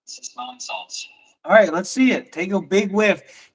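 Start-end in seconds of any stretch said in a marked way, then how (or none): 0.1-1.1 hushed whispered repeat. Real, not acted. Streamer repeating his friend's words back, whispered. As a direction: breathe the words back under your breath.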